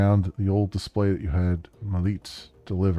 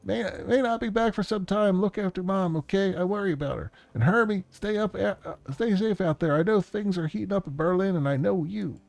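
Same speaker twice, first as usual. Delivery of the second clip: high-pitched, worried brother with halting starts; urgent, jittery man; 11 semitones higher than usual. Acted. Man leaving on a mission warns Hermie, high and urgent. Plays excited man in recording.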